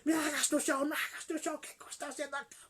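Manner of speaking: high-pitched imitated begging, softening at the end; desperate pleading. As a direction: act out a wife pleading on her knees. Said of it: "en su desesperación, se arrodilló". (súplica desesperada y aguda, imitada)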